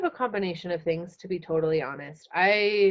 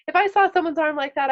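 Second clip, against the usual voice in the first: ordinary delivery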